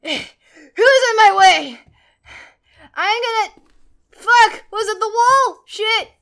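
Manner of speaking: high, loud angry swearing; exasperated yelling; pissed off. Real, not acted. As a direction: shout in real frustration at the obstacle. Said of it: "I'm so pissed off right now".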